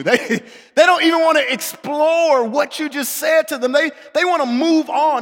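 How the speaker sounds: loud, high, driving sermon voice; fervent preaching. Acted. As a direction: preach it out full force. Preaching at full force.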